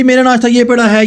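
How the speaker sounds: ordinary talk, nothing marked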